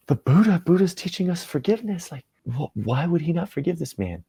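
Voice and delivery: high-pitched, hushed disbelief; shocked disciples. Acted. Stunned followers wondering why he won't forgive, high disbelief. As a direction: question it in a high, baffled voice. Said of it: "everyone just gasps at that".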